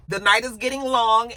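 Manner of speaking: ordinary talk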